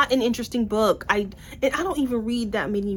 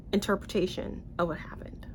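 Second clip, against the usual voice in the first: "interpretation of what happened" whispered; conspiratorial whisper; 8 dB quieter than usual. Real, not acted. Reviewer confiding to viewers, whispering. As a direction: let it drop to a secretive whisper. Sarcastic aside.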